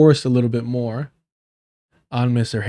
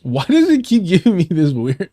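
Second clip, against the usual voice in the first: higher-pitched exasperated complaint; 5 semitones higher, 4 dB louder than usual. Real, not acted. Streamer complaining about a pronunciation tool, exasperated. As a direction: complain in frustration, pitch raised.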